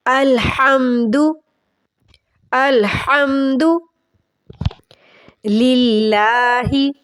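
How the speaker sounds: drawn-out chanted अलहम्दु लिल्लाहि; slow recitation. Shown as a demonstration. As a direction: recite slowly with rising tajweed melody. Tajweed recitation demo.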